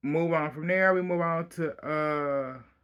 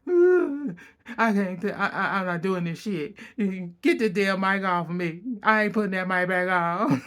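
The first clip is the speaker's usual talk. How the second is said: mock crying; 4 semitones higher than usual. Retelling Lisa's tearful refusal, hammed-up crying. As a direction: wail it like a fake sobbing victim. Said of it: "She crying like".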